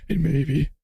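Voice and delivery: fading to a whispered mock old man; Biden voice. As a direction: trail off into a hush. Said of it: "Let me do the Biden voice".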